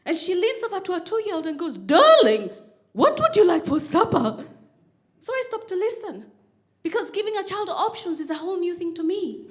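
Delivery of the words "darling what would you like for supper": hammed-up posh mother's voice; imitation of a well-to-do mum addressing her toddler; posh woman. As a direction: call out "Darling" grandly, loud and enunciated. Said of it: "there's a very posh woman in there".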